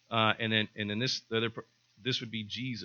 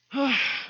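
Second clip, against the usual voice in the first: mock sigh; 7 dB louder than usual. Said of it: "they might say something like".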